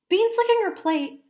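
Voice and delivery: high, loud tattling sister voice; tattling big sister. Acted. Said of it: "said Nancy".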